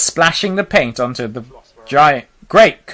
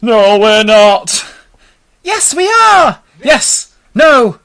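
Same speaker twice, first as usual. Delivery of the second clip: high, loud back-and-forth cries; panicked bickering; 13 semitones higher, 7 dB louder, slower than usual. A narrator's reading. Travellers argue over hitting the rainbow, panicked shouting. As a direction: squabble in rising panic, pitched high.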